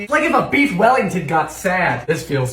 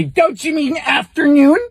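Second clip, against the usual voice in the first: whiny know-it-all voice, a little higher and louder; mocking pedant; 6 semitones higher, 5 dB louder than usual. Mimicking the smug person who corrects good morning. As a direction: sneer it back like a smug pedant.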